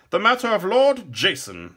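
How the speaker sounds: high, loud announcement; proud herald. A narrator's reading. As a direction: proclaim the next matter to the court. Herald announces the next matter.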